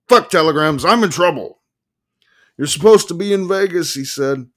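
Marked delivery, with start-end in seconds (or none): 0.1-1.6 loud, high exasperated yell; frantic outburst. A narrator's reading. Frantic quarrel on the phone. 2.6-3.9 high, loud scolding voice; exasperated attorney. A narrator's reading. Attorney scolds caller by phone.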